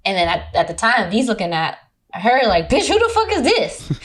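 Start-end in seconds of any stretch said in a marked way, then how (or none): 2.7-3.9 high-pitched mock disbelief; high, sassy "who is this" reaction; mock incredulous. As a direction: act out a friend's judgy disbelief. Friend's silent reaction, acted out.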